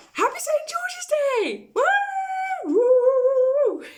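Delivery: high, loud festive whoops; a high, loud cheer of woo; excited cheer. Real, not acted. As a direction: whoop it up for the holiday. Celebrating Saint George's Day.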